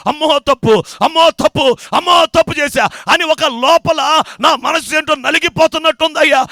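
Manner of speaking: loud, building wail of confession, voice rising; anguished penitent. Acted. Penitent confesses his sins to the pastor in a loud building wail.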